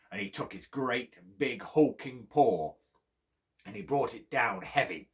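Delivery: ordinary delivery, nothing marked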